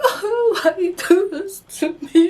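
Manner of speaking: high, laughing sob of a question; 울먹이며 높게 하소연하며; mock crying wail. Retold crying outburst.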